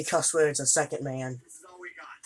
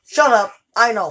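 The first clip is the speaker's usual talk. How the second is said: loud, higher, irritated "shut up"; annoyed snap; 5 semitones higher, 10 dB louder than usual. Real, not acted. Player telling the repeating soldier to shut up, annoyed. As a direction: cut the voice off sharply, louder. Snapping at the game voice.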